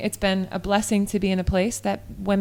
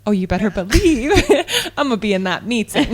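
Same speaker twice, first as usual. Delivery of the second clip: laughing defiance; 5 dB louder than usual. Retold or imitated. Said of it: "I was like".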